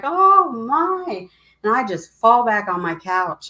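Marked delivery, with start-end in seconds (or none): 0.0-1.2 high, loud astonished oh my; stunned gasp. Retold or imitated. Reading the news she's been waiting for, stunned cry of disbelief. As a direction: blurt it out in shock. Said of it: "I'm like".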